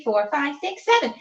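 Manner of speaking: ordinary delivery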